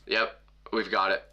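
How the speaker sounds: a hint of a jaded counter worker; bored clerk. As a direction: play a clerk who hates his job, understated. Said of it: "looks like he hates his job".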